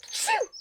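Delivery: high sharp sneeze; sudden achoo. Acted. Sneezing spell.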